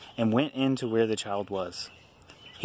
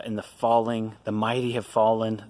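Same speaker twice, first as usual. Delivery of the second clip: usual speaking voice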